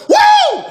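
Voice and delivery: very high, loud victory whoop; triumphant whoop. Retold or imitated. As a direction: let out a big celebration yell. Celebrating the pancake block.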